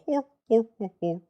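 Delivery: mock hor hor chuckle, fading; light cartoon bear laugh; Freddy laugh. Asking if Toy Freddy laughs, mock chuckle. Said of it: "Does Toy Freddy even go".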